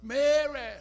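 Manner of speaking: calls the name out loud and high; calling out. Acted. Servant calling Mary to come to the master, loud call. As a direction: shout her name across the house.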